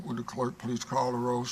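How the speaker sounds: usual speaking voice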